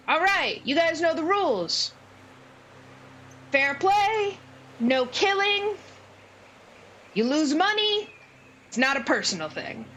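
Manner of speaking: high-pitched, projected gnome announcer; booming little showman welcoming a crowd. Acted. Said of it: "bizarrely booming voice".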